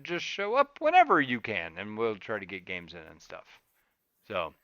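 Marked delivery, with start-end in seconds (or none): none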